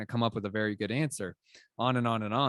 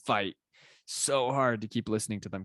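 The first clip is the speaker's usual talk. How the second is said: ordinary talk, nothing marked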